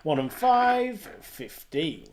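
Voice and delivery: sing-song rising then falling number calls; bingo caller. Acted. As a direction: call each number like a bingo hall. Online bingo number calling.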